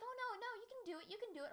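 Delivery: high squeaky mock cheering; mock encouragement. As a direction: cheer them on in a silly high voice. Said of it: "They're like".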